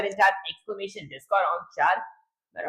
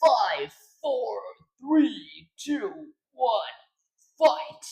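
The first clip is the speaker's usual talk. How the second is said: raised, punchy countdown voice; game countdown announcer; 5 semitones higher, much slower than usual. Shown as a demonstration. Streamer auditions a fight countdown, punchy announcer voice. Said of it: "I'm gonna go with something like".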